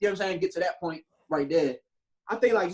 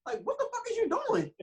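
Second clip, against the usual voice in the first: stunned disbelief. Retold or imitated. Retelling what he said to his mom, high and shocked. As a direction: react like it makes no sense at all. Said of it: "I'm like".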